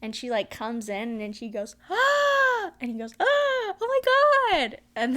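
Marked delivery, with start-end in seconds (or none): 1.9-2.7 mock startled squeal, pitched way up; a quick high imitated gasp-word; hammed-up surprised cry; high startled yelp. Girl in retold video exclaims. 3.2-4.8 high, startled imitation of a kid; hammed-up amazed gasp; mock shocked kid. Kid in retold video reacts.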